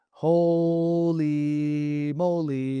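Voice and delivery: drawn out, very slow holy moly; amazed. Real, not acted. Teacher spotting a big hole, slow amazement. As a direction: stretch it out in wonder.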